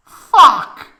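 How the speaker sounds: high, loud shrieking fuck; shrieked curse. A narrator's reading. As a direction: scream it in high frustration. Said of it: "groaned Tifa".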